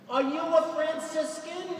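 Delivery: high, slow Long Island lady calling out; a loud, drawn-out Long Island accent from the back of the church; an old Long Island woman calling to the friar, in a high Long Island accent. Retold or imitated. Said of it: "That's a Long Island accent".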